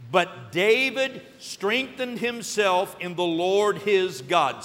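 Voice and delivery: slow congregational reading. Shown as a demonstration. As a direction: read it aloud together, slowly. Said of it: "read that with me".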